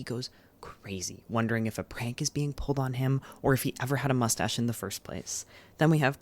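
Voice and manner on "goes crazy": sudden hushed whisper for the twist. Real, not acted. Telling viewers the book's premise in a dramatic whisper. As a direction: drop to a spooky breathy whisper.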